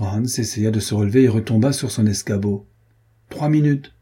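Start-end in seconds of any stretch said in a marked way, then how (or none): 3.3-3.9 shouting. A narrator's reading. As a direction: bark out the last warning. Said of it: "cria Carquefou".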